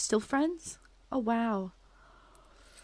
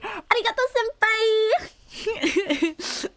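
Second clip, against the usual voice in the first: high squeaky cutesy anime voice; uwu voice; 13 semitones higher, 4 dB louder, slower than usual. Shown as a demonstration. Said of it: "then I can do an uwu voice".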